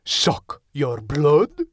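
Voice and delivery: put-on slow, drawn-out Eurotrash vampire accent. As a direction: ham up a Eurotrash vampire, stretching each word. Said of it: "I put on my best Eurotrash vampire accent".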